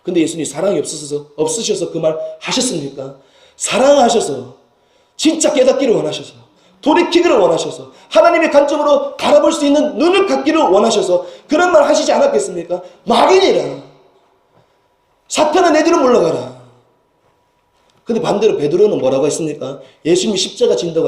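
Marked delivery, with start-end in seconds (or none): 3.5-12.8 rising preaching fervor. Acted. Sermon climax, mounting fervor. 13.0-16.6 사탄아 thrown out high and loud, the whole rebuke ringing higher; forceful rebuke. Retold or imitated. Preacher re-voicing Jesus rebuking Peter, loud and high.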